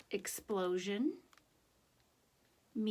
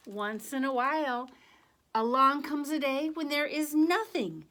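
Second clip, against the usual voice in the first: put-on lively, higher read-aloud voice for children; story narration; 4 semitones higher, 4 dB louder than usual. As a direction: tell it to the kids with a sense of wonder. Reads picture book to class.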